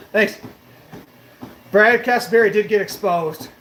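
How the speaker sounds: ordinary talk, nothing marked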